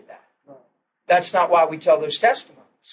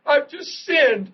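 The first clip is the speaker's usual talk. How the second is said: high, drawn-out mock wail; mock tearful confession; 9 semitones higher, slower than usual. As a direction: confess as if crying, stretching it out. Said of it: "I'm crying in the men's room".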